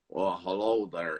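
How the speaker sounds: slow, low Southern drawl imitating an old golfer. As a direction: stretch out "hello there" in a lazy Southern drawl. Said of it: "It's like southern drawl".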